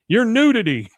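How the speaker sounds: loud, high outcry; mock horror. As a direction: exclaim as if appalled. Hank appalled at naked hippies.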